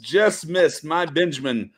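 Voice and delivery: ordinary talk, nothing marked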